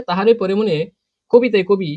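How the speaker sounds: ordinary talk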